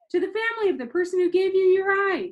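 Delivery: high, loud scolding grandma voice; exasperated grandma. A narrator's reading. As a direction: chide him like a fed-up grandmother.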